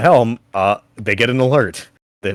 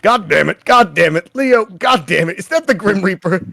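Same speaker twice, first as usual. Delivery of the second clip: high, raised gruff cursing; 10 semitones higher, 6 dB louder than usual. Acted. Gruff character cursing and calling for Leo, high holler.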